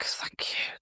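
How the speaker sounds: breathless tearful whisper; whispered thanks. Retold or imitated. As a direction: whisper it, barely able to speak. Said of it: "I barely said a word".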